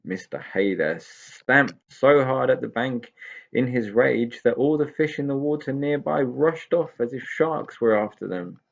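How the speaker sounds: ordinary delivery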